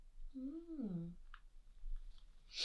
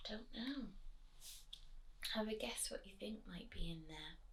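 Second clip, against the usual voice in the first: whisper; 3 semitones lower, 19 dB quieter than usual. Real, not acted. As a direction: whisper it close. Whispered aside to child.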